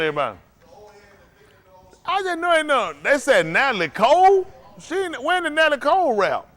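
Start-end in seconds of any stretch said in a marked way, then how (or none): 2.0-6.5 high-pitched disbelief; scandalized, pitched way up; incredulous. Real, not acted. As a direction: question it in a high, astonished voice. Host disbelieves a team's answer.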